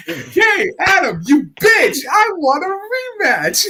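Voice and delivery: hammed-up screech; mock Sonya shout. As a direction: scream at Adam for a rematch. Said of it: "He's like".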